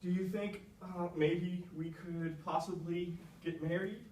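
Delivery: slow, halting, nervous proposal; stumbling question. Acted. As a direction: stumble shyly through the proposal. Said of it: "starts stumbling over his question".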